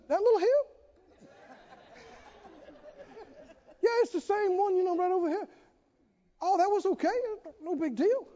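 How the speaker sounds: high-pitched, lightly acted nonchalance; breezy dismissal. Brother [name] answers about the mountain, high breezy dismissal.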